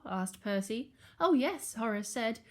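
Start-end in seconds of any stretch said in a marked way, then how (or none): 1.2-1.7 high, eager little pig; bright-eyed yes, pitched well up. A narrator's reading. Horace the pig answers his doubting brother, eager.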